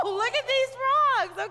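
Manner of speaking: high, loud, stretched-out exclamation; excited. Real, not acted. Teacher marvels at kids' frog jumps, excited. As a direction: exclaim in amazement, voice shooting up.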